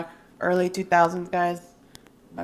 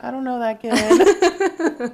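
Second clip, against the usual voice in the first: slow, higher, drawn-out snooty disowning; embarrassed denial; 5 semitones higher, 6 dB louder, much slower than usual. Retold or imitated. Sister recalling disowning her crying brother, slow snooty denial. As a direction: pretend not to know him. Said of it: "I would walk away and be like".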